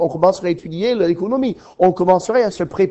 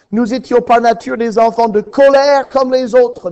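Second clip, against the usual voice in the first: louder proclaiming of the verse; fervent preaching; 4 semitones higher, 7 dB louder than usual. Acted. Preacher proclaiming Ephesians to his congregation, fervent. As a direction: proclaim it to the whole church.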